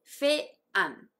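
"フェアン" split into "feh... an"; native pronunciation. Shown as a demonstration. Teacher breaking it down, "フェアン" as separate "feh" and "an". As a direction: break "フェアン" apart to show both vowels. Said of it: "ネイティブはエフエーエヌをフェアンと".

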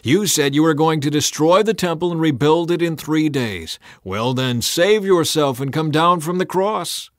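loud, high taunting; mocking shout. A narrator's reading. Passers-by taunting Jesus on the cross, loud mocking shout. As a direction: taunt him loudly, daring him. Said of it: "shouted abuse".

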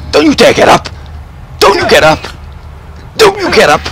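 high, heated yelling at enemies; threatening shout. Real, not acted. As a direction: warn them off at a yell. Fighting downed enemies in game.

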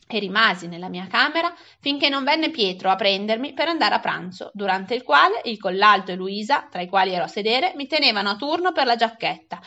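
usual speaking voice

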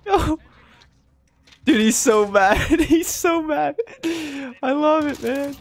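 high, slow, wheezing laugh-cry; helpless laughter at the furious player. Real, not acted. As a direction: laugh until the words squeak out. Cracking up at raging player.

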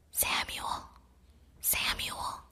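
soft breathy call from afar; a distant whispered call; gentle whisper. A narrator's reading. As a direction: call his name gently, barely voiced. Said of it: "the Lord said in a gentle voice".

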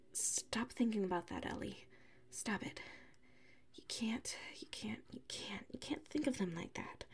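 soft, urgent whisper to herself; self-scolding whisper. Acted. Ellie scolds herself in a soft urgent whisper. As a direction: hiss it under your breath to snap out of it.

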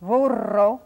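"Burro" with a long rolled R; Spanish "Burro", R strongly rolled; trilled Spanish R. Shown as a demonstration. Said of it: "and a trilled R".

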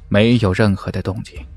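usual speaking voice

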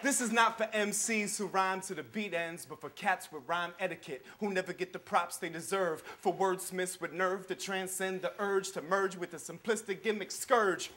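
quiet, measured slam poetry recital; spoken-word poem. Acted. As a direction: hold each line, let the pauses land. Spoken-word poem over music.

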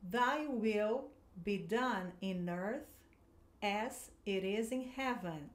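slow, deliberate English phrasing; teacher reading the prayer slowly to the class; slow model reading. Shown as a demonstration. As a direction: lay out each word slowly. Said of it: "você vai praticar a pronúncia".

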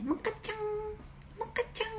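high, soft sing-song call to a pet; high sweet pet-calling voice. Real, not acted. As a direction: coax the pet over sweetly. Calling her pet Moka.

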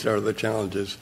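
ordinary delivery

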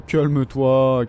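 calme-toi stretched very slow and firm; mock soothing, drag out calme-toi; slow teasing hush; drawn-out calme-toi. Soothing the enemy player mockingly.

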